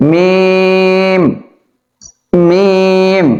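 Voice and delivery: মিম with lips closed, the m held four beats long; long drawn-out meem. Shown as a demonstration. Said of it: "দুই ঠোঁট লাগবে এবং লম্বা হবে".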